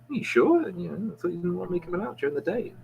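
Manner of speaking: high, quick are you sure; surprised homeless man. Acted. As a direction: jump up high in surprise then rattle on fast.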